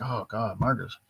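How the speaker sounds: soft, pitying imitation of a sympathetic reader; hushed, acted-out pity; feeling sorry. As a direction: drop the volume in mild mock sympathy. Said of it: "you kind of feel sorry for Marcus".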